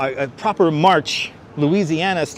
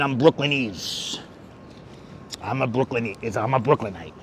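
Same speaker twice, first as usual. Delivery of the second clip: put-on lower, thick Brooklyn accent; 6 semitones lower, 7 dB quieter than usual. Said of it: "Deb likes my Brooklyn accent".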